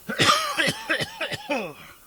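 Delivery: sudden loud, very high cough; a sharp high-pitched coughing fit. Real, not acted.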